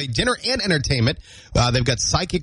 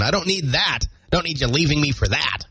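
usual speaking voice

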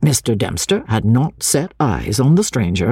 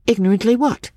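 sharp, high-pitched query; 10 semitones higher than usual. A narrator's reading. Miss Halcombe demands the schoolmaster finish his word, sharp. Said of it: "inquired Miss Halcombe sharply".

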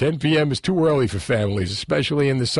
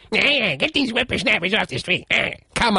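lightly voiced cranky old geezer, higher; old prospector; 8 semitones higher than usual. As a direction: grumble it like a grouchy old-timer. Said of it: "These old farts".

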